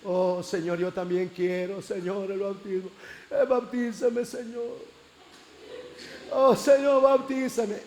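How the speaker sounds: súplica llorosa imitada, cada vez más aguda; llanto fingido. Retold or imitated. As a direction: fingir que lloras mientras ruegas. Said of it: "Estaba llorando". (lloriqueo devoto exagerado)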